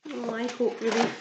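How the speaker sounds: ordinary delivery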